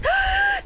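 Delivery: high startled intake of breath; sharp gasp. Real, not acted. Gamer startled mid-game, sharp gasp. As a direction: gasp in sudden shock.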